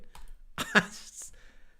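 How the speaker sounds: breathless high-pitched giggle. Real, not acted. Podcaster catching his own mistake, high breathy giggle. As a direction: giggle at your own mix-up.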